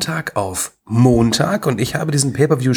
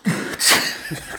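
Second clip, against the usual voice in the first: blowing nose; 5 dB quieter than usual. Real, not acted. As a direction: blow your nose hard like an elephant. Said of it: "War da ein Elefant irgendwo?". (lautes Naseputzen)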